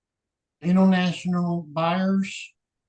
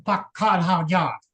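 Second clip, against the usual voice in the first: imitated Boston accent with dropped R's. Explaining an old joke from Boston, imitated Boston accent. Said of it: "Their accent, they go".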